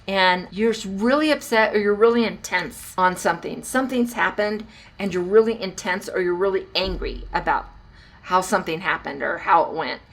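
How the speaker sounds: ordinary talk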